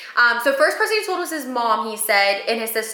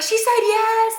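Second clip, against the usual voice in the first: crowd cheer; 11 semitones higher, 3 dB louder, slower than usual. Retold or imitated.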